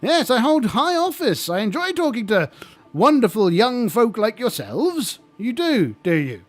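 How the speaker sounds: high, jolly lich host voice; chirpy undead host, eager to please; friendly lich. Acted. Lich greeting the young adventurers, high and chummy.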